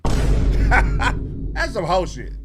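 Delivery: loud, slowly drawn out through laughter; laughing repeat. Real, not acted. Repeats the clip's line laughing.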